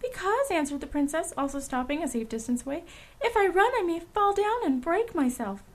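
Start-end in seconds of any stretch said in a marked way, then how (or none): 0.0-0.5 high, small princess voice; little China princess. A narrator's reading. Princess answering Dorothy from a safe distance, high little voice. 3.2-5.6 high, delicate princess voice; little China princess. A narrator's reading. Princess explaining why she runs, high little voice.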